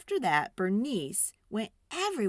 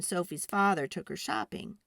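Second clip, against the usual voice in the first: ordinary delivery, nothing marked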